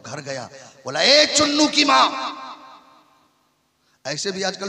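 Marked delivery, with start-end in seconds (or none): 1.0-2.1 higher call across the house to the wife. Retold or imitated. Husband calling his wife. (पत्नी को पुकारना)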